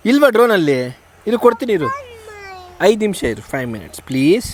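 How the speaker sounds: high, loud promise. Real, not acted. Aunt promising the drone to the child, high and coaxing.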